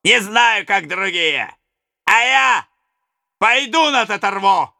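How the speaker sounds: high, loud reckless cry; young daredevil. Acted. Young daredevil stepping out before the robbers, high reckless cry. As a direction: throw caution to the wind. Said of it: "молодой сорвиголова".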